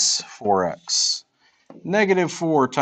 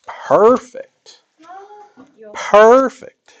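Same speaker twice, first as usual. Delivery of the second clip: high, loud delighted perfect; excited praise; 11 semitones higher, 7 dB louder, much slower than usual. Real, not acted. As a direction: cheer it out, delighted. Teacher praising loudly.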